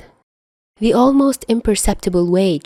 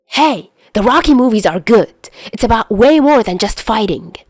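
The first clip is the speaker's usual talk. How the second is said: higher, louder indignant defense; offended protest; 5 semitones higher, 4 dB louder than usual. Acted. Chuya defending the Rocky movies to Dazai, louder indignant protest. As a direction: stick up for Rocky, stung.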